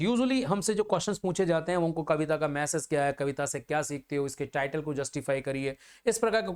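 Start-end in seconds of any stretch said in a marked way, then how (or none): none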